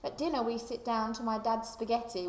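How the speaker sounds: plain reading voice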